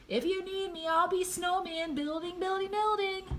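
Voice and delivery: high, sustained singsong call; excited high-pitched announcement. A narrator's reading. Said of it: "He called to his dad".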